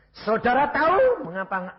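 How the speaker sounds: loud, high challenge; confronting shout. Retold or imitated. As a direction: demand it at full voice. Retells confronting his would-be killer. (bentakan lantang menantang)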